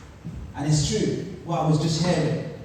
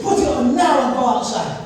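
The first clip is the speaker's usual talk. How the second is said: stern mum; 7 semitones higher, 7 dB louder than usual. Retold or imitated. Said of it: "She said".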